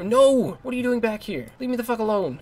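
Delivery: high, loud exasperated yell; fed up, yell at them to leave; exasperated outburst. Real, not acted. Gamer yelling at returning enemies, high and exasperated.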